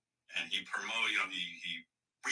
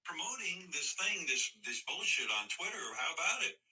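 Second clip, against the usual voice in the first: higher, softer mock questioning reporter; 7 semitones higher, 5 dB quieter than usual. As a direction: needle him like a nosy reporter. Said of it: "the reporter's saying".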